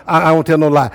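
usual speaking voice

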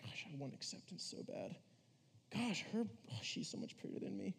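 breathy whispered inner longing; mock whispered insecurity. Youth pastor voicing a teen's private thoughts in a hushed whisper. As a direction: whisper a secret envy to yourself. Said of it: "you're like".

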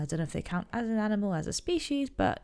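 normal talking voice

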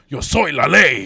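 loud booming movie-dub delivery; deep Stallone dub voice. Retold or imitated. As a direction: boom it like a dubbed action hero. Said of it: "just hearing him say".

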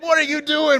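very high, loud call from far off. Retold or imitated. Friends at the RV calling to him, very high and loud.

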